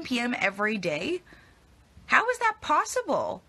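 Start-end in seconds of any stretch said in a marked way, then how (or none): 2.1-3.4 much higher, louder, slow disbelief; astonished. Real, not acted. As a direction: gasp it in disbelief. Shocked at early wrap time.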